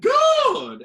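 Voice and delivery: high, loud "Good!"; teacher praising the class; loud praise. Retold or imitated. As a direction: snap out the praise.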